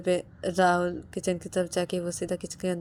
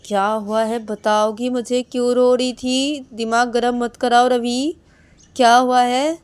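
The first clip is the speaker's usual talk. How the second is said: much higher, loud hammering questions; angry demand; 5 semitones higher, 12 dB louder than usual. Acted. Husband demanding why his wife cried, loud and angry. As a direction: keep demanding louder each time.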